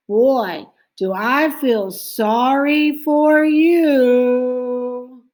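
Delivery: slow, drawn-out teasing sympathy; mock pity. A narrator's reading. Francine teasing Arthur about the dentist, mock pity. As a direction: pour on the fake pity. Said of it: "asked Francine".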